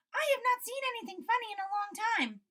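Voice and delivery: high, fast mouse voice; gleeful little mouse; excited mouse. Acted. Field mouse eager for fun, high and quick.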